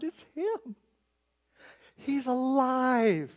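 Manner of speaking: hushed disbelief rising to high, drawn-out wonder; awestruck recognition. Acted. Thomas realises his Lord is alive, awestruck. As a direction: let amazement build, pitch climbing as it sinks in.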